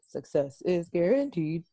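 slow, robotic recitation; measured, mechanical delivery; programmed. Shown as a demonstration. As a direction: recite it slowly like a programmed line. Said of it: "he said it so programmed".

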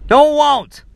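indignant. Real, not acted. Ranting at a headline.

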